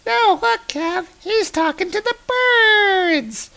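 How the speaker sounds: put-on very high squeaky kid voice. Kids find Baldur talking nonsense, high squeaky kid. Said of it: "the kids are like".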